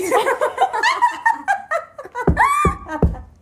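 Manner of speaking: shrieking laugh; high shrieking laugh. Real, not acted. Laughing at her own joke, high shriek. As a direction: squeal with laughter.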